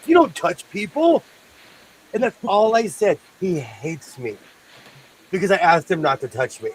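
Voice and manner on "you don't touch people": firm rebuke. Retold or imitated. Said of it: "and I said".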